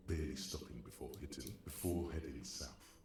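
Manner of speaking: ordinary talk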